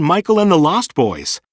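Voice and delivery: usual speaking voice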